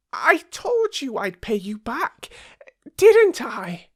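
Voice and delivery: very high squeaky little mouse voice; a tiny mouse piping up to reassure. Acted. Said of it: "said Mouse".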